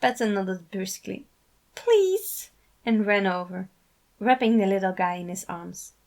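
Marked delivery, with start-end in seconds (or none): 1.7-2.5 eager. A narrator's reading. Patton accepting hug eagerly.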